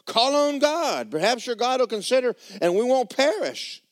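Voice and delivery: high, loud, desperate captain shouting at the sleeper; frantic sea captain pleading over the storm; panicked ship captain. Acted. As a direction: wake him up in a high panicked yell. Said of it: "And the captain of the ship said".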